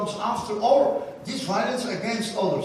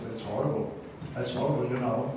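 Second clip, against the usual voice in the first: much lower, softer, grieving; God crying; 8 semitones lower, 6 dB quieter, faster than usual. Retold or imitated. God answering Moses in grief, low and hushed. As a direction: sink low into a hushed lament. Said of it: "that God was crying".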